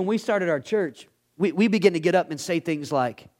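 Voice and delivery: normal talking voice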